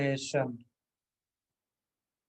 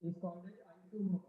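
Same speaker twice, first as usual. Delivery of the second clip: faint, cutting-out audio; barely audible; 15 dB quieter than usual. Real, not acted. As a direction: voice dropping out on the call. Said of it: "sir, आपकी आवाज कम आ रही है।".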